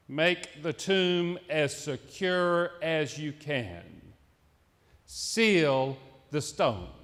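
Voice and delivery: slow and hushed, letting each word land; slow, solemn echo. Shown as a demonstration. Preacher echoing Pilate's order, slow and hushed. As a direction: repeat the order slowly, as if sealing it. Sermon repeats the order slowly.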